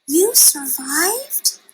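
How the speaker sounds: high, slow, astonished; amazed joy. A narrator's reading. Stella Luna overjoyed her mother survived, amazed. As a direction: gasp it out in wonder.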